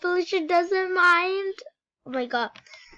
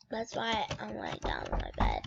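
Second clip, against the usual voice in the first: whisper; 8 dB quieter, faster than usual. Real, not acted. Sick, lying down.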